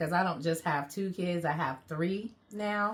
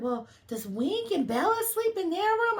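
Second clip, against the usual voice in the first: put-on child voice; 12 semitones higher than usual. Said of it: "And he said".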